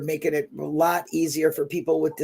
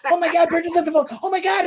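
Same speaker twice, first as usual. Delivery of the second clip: high-pitched re-enacted thrill; retold excited outburst; 9 semitones higher than usual. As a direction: squeal the news as if it just happened. Retells getting Brendan's surprise call.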